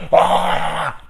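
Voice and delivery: high, loud mock gasp; hammed-up high gasp; mock gasp of realization. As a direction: gasp like a viewer who just got it. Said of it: "they're like".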